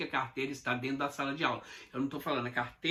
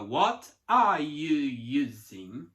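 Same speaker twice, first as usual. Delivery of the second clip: slow, every word pulled apart; 6 dB louder, much slower than usual. Shown as a demonstration. Teacher showing the wrong choppy way, slow and separated. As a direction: say each word apart, slowly. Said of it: "eu não posso falar".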